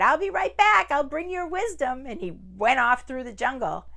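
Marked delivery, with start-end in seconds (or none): 0.0-2.0 high, loud cheery farewell; cheerful man. Acted. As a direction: call back brightly while leaving. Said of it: "He said".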